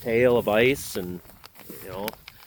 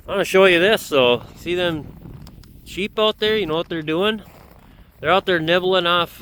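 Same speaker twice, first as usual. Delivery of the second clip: raised, higher voice to carry over wind; shouting over wind; 7 semitones higher than usual. Real, not acted. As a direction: call out over the wind outdoors. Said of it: "Hopefully you can hear me over the wind".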